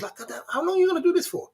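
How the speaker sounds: high, fast, disbelieving squeal; mock them for keeping at it; incredulous mockery. Real, not acted. Mocking haters who keep going.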